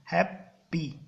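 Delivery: Happy in two detached beats, "hap - pee"; split into syllables. Shown as a demonstration. Teacher repeats for students that it has two syllables, happy split. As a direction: break happy with a clear gap after the first syllable. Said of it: "देखो दो syllable है".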